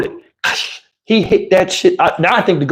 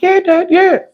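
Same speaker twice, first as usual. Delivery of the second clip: high, eager yes; nervous kid; 12 semitones higher than usual. Retold or imitated. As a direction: agree fast like a scared kid. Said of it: "I was like".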